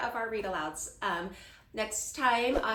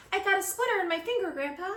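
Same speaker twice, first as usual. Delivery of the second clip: put-on high, fast, upset kid voice; high complaining child; whiny child; 9 semitones higher, 5 dB louder, faster than usual. A narrator's reading. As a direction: rush the complaint to grandpa.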